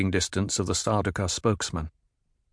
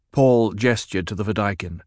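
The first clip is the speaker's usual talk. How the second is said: plain reading voice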